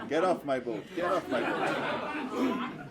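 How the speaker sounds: loud, high and stretched out, laughing through it; hammed-up huffy command. Boat owner ordering the beggar girl off, hammed-up squeal. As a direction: drag it out in a mock offended squeal. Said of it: "So he says".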